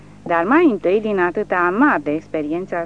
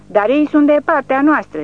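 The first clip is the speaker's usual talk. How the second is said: much higher and louder astonished shriek; stunned cry; 9 semitones higher, 9 dB louder than usual. A narrator's reading. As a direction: cry out in disbelief. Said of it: "țipă ea uluită".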